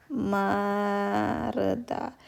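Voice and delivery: Mareda stretched very slowly, clear tapped r; slow model word. Shown as a demonstration. Teacher modelling Korean word for students: slow, drawn-out Mareda. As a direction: draw out Mareda syllable by syllable. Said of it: "Dia disebutnya tetap R".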